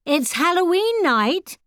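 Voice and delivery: high, slow call through a door. A narrator's reading. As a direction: call out to the bedroom. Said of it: "Sally Ann shouted".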